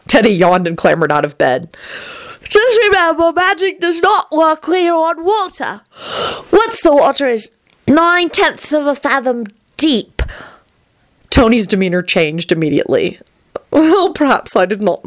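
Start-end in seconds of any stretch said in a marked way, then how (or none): 2.4-10.2 rapturous. Acted. Vampire Tony's voice. 13.7-15.1 rapturous. Acted. Vampire Tony's voice.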